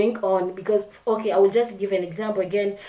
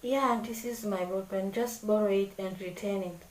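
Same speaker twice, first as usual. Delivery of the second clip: offhand and grudging, much softer; careless lending; 10 dB quieter than usual. Shown as a demonstration. Lending a pen to a classmate, grudging offhand tone. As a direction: lend it like you couldn't care less. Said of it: "if I'm someone who does not observe my actions".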